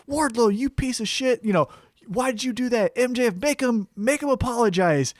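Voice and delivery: high, indignant ranting; angry Jericho. Retold or imitated. Jericho backstage yelling at Wardlow, indignant. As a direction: rant at him like a betrayed veteran. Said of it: "Chris Jericho's like".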